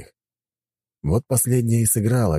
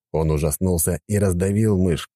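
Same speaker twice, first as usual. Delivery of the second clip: ordinary delivery, nothing marked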